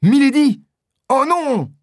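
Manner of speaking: very high, loud exclamation; soaring cry of alarm; horrified cry. A narrator's reading. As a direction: cry out in dismay. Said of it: "s'écrie D'Artagnan".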